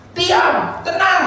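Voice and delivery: high, slow, drawn-out shout; mock scolding yell at running kids; yelling at children. Shown as a demonstration. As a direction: holler each word out. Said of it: "saudara bilangin nggak?".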